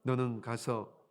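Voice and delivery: low, measured reading; scripture reading. Acted. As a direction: read the verse aloud solemnly.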